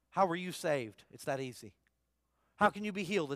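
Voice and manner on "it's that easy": much softer and lower, almost confiding. Real, not acted. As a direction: drop the voice to let it land. Preacher stressing simplicity.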